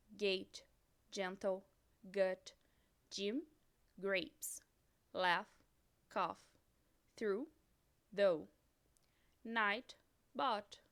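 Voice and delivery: each English word said clearly with pauses; teacher reading a vocabulary list in English; English model words. Shown as a demonstration. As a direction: model the English pronunciation for repeating. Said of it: "pode ter som de F ou W ou ser mudo".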